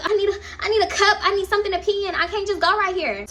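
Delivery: high panicked whine; panicky. Retold or imitated. Drunk friend panicking.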